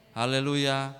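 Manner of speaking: usual speaking voice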